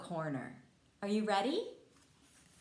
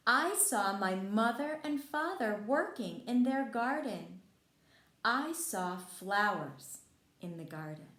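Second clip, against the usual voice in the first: bright, lively storybook reading, louder and higher; reading to children; 3 semitones higher, 5 dB louder than usual. A narrator's reading. Teacher reading Frog and Toad to kids, lively storybook voice. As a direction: read it out like a teacher at story time. Reads picture book to children.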